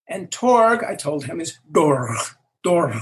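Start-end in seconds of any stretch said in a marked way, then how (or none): 1.4-3.0 Dorg with a guttural, rasped final consonant; Klingon pronunciation. Shown as a demonstration. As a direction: end each Dorg in a throat-scraping gh. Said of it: "like that".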